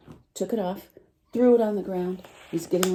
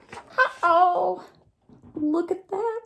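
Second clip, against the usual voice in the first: surprised; 12 semitones higher, 3 dB louder, much slower than usual. Acted. Narrator reacts to monkeys in tree.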